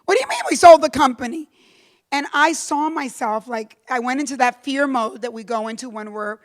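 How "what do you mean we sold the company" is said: louder, fast shocked "¿Qué?"; stunned employee demanding answers; hearing the company was sold, louder and fast in disbelief. Retold or imitated. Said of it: "I go".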